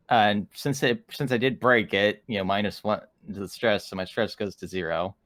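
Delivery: normal talking voice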